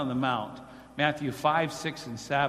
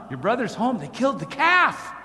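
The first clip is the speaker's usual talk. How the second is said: servant reporting; 6 semitones higher, 5 dB louder than usual. Acted. Servant answers brother.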